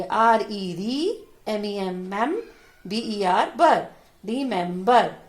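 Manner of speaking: "Remember" built slowly from री, मैम, बर; slow teacher's sounding-out of "remember"; spelled out by syllable. Shown as a demonstration. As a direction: spell each part of "remember", say it, then join them. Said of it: "इनको हम अलग-अलग करके पढ़ेंगे".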